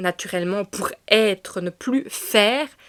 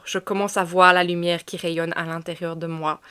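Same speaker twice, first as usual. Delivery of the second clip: usual speaking voice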